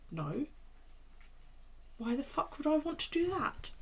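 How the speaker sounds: a hint of a grumpy dog's inner voice; mock indignant pet reply; voicing the dog. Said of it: "She's looking at me like".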